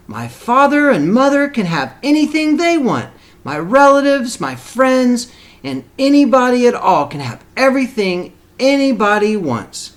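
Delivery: excited donkey. A narrator's reading. Young donkey Sylvester, thrilled by the magic pebble, squeals with joy. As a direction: squeal it out in delight at his luck. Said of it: "thought Sylvester".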